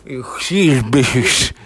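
mocking slow lisped voice; lisp imitation.

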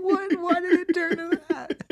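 high, loud shriek through laughter; laughing disbelief. Real, not acted. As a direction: protest loudly while cracking up. Incredulous outburst while cracking up.

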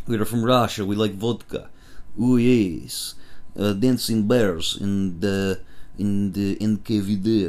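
hammed-up stock Russian accent; cartoon Russian accent. Mocking Hollywood's same-sounding Russians, in a hammed-up Russian accent. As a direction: play the cliché movie Russian, in a Russian accent. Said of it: "everybody would probably talk like this".

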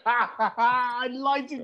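high, slow, laughing confession; laughing admission. Real, not acted. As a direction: laugh helplessly into the words. Laughing at own mistake.

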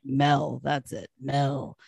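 slow, lazy sleazy drawl; lightly voiced sleazy camp owner. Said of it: "He's just like".

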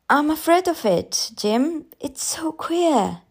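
high, uneasy voice slowing at the end; fearful confession. A narrator's reading. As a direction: admit dread to a husband. Mother confesses her fear.

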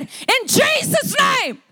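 high, drawn-out shouted rebuke; frustrated command. Retold or imitated. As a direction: cast it out in retold exasperation. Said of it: "he got frustrated and angry".

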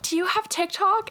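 high-pitched quoted question; imitated teenage girl. Girls coming over to ask her, imitated fangirl.

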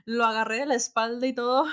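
normal talking voice

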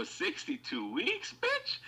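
high, slow disbelieving squeal; mock outrage, pitch shooting up; incredulous. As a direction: rise up to a squeak on bitch in disbelief. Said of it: "I go".

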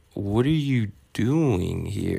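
slow, stretched-out mock surprise; drawn-out disbelief. Teen finding his date's sister at the mall, baffled. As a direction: draw the question out slowly, as if baffled.

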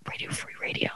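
hushed whisper. Real, not acted. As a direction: whisper it so he can't hear. Avoiding saying the name.

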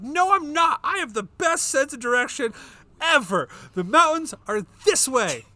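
loud, high, stubborn insistence; insistent shout. Retold or imitated. To wife while driving lost, a loud high insistent protest. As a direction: refuse to admit being lost, loudly. Arguing with wife over directions.

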